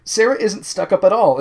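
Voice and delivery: ordinary delivery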